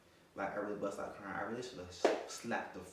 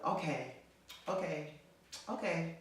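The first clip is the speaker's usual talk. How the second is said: higher, separated okays through a smile; forced smiling acceptance; 7 semitones higher, slower than usual. Retold or imitated. Woman to herself while reading his messages, forced smiling acceptance. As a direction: smile and keep telling yourself it's fine. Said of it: "like, just smiling".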